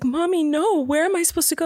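put-on high, protesting little-kid voice; imitating upset child. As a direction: play a small boy objecting to mom. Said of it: "he was so upset".

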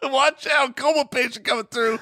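high laughing announcer voice; mock coma patient. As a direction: call it out while laughing. Mock coma patient rolling downhill.

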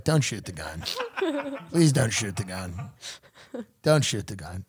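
low, limp, unbothered plea; mock unaffected calm. Mimicking a calm man on a cot, low and limp. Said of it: "kinda laying there unaffected".

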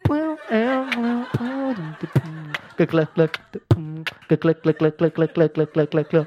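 beatbox the wine-bottle clicks. Retold or imitated.

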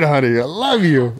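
high, loud screamed honey I love you; screaming. Retold or imitated. Husband screaming love back at wife across the bar. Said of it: "Yelling more out loud".

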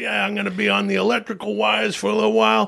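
Long Island regular giving his opinion; Long Island accent. Acted. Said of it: "that's a Long Island accent".

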